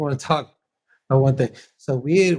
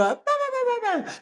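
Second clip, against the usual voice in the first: high-pitched mock bickering babble; wordless squabbling, pitched very high; imitated arguing noise; 5 dB quieter, faster than usual. Father imitates his side of an argument, high mock babble. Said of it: "we just go".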